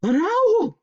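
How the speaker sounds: very high, loud frightened screech; terrified chipmunk screech. Acted. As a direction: shriek in fright. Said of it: "screeched Huggleduck".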